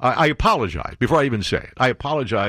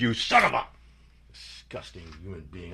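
muttered insult; 5 semitones lower, 8 dB quieter than usual. Real, not acted. Host insulting the president aside, muttered far softer.

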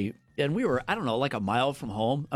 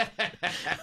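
soft laugh; 4 semitones higher, 5 dB quieter than usual. Real, not acted. Laughing at his own prank.

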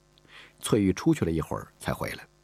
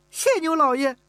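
put-on very high, louder girl's cry of joy; overjoyed thanks; 5 dB louder than usual. Girl thanking the master after he agrees, overjoyed. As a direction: jump up and thank him. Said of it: "高兴地跳了起来".

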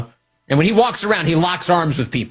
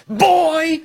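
mock yell; 13 semitones higher than usual. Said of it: "He's yelling".

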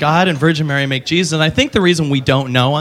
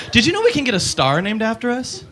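ordinary delivery

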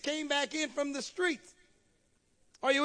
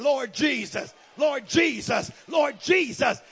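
forceful repeated call, building louder; emphatic invocation; 5 dB louder, slower than usual. Shown as a demonstration. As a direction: call out the name like a weapon against the devil. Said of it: "just start saying".

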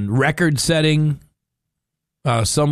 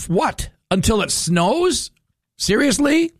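climbing higher in outraged disbelief, ending far higher on seriously; can't believe what he's reading; rising disbelief; 9 semitones higher than usual. Real, not acted. Radio host reading the fire news, rising incredulous disbelief.

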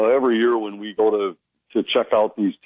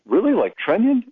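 "Trenyon" asked with a sharp rising surprised tone; surprised question; 10 semitones higher than usual. Retold or imitated. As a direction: repeat "Trenyon" back in disbelief, the voice jumping high. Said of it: "And so we said".

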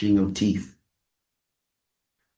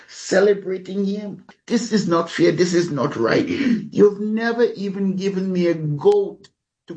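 higher, aggrieved whining protest; resentful older son; 7 semitones higher than usual. Retold or imitated. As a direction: complain like a wronged older brother to his father. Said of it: "He said, "Dad," and I'm gonna paraphrase".

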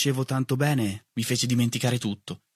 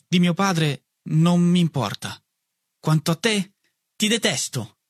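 coolly spoken, a touch higher; cold; 6 semitones higher than usual. A narrator's reading. Boy coldly telling his mother he hates her, icy. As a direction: say it icily, holding back tears. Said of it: "dissi freddamente".

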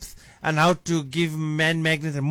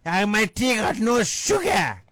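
husband protests his tea has no sugar, voice rising high; husband complaining; 6 semitones higher than usual. Retold or imitated. Complaining husband shouts that his tea is unsweetened. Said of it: "He said".

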